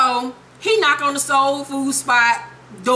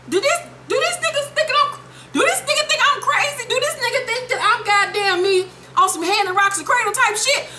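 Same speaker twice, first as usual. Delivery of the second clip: high, squeaky mock-outraged rant; mock-indignant high-pitched voice; outraged disbelief; 11 semitones higher than usual.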